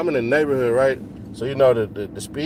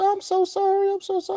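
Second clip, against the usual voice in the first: much higher squeaky imitation of a flustered woman; hammed-up high, slightly softer sorry-lady voice; mock apologetic lady; 4 dB quieter than usual. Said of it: "The lady was apologizing".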